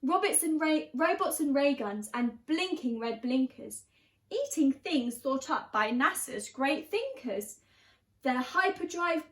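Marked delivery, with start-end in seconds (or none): none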